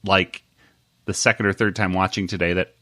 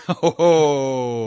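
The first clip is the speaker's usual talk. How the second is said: ordinary delivery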